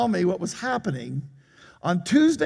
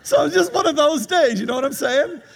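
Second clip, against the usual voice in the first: laughing; 10 semitones higher, 3 dB louder than usual. Real, not acted. Laughing at his own story.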